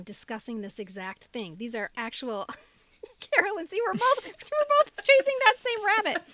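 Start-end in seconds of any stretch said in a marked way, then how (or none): 2.5-6.2 laughing. Real, not acted. Excited laughing realization.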